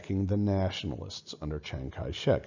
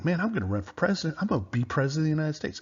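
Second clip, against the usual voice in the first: ambitious inner thought; 8 semitones higher, much faster than usual. Retold or imitated. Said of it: "he's thinking".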